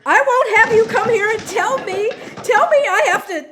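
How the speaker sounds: high, loud furious outburst; enraged shouting. Acted. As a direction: yell it in a rage at your mother. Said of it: "you fly into a rage".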